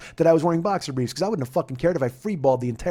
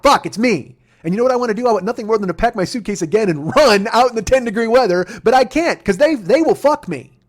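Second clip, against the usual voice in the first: louder and higher, building into a heated rant; exasperated rant; 6 semitones higher, 7 dB louder than usual. Real, not acted. Comic griping to the audience about being singled out by security, heated and rising. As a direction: vent it, getting hotter line by line.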